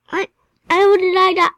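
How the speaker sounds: high, loud cry of disbelief; indignant disbelief. Real, not acted. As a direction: demand an answer, loud and high. Repeats question, outraged at dying.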